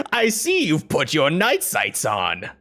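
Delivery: slow, swooping villain delivery; theatrical villain. Acted. Villain taunts Snake about his night sights, theatrical. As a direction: relish each word like a gloating villain. Voices game villain Marionette Owl.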